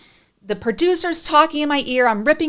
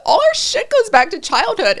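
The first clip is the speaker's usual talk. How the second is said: emotional high outburst; 11 semitones higher, 5 dB louder than usual. Real, not acted. Emotional outburst about childhood.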